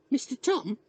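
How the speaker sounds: put-on high-pitched small boy calling for attention; little boy starting a question; young boy's voice. Boy addresses the old man.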